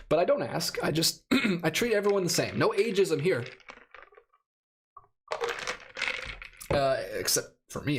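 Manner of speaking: losing their voice, a bit hoarse. Real, not acted. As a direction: voice going hoarse. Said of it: "I'm kind of losing my voice".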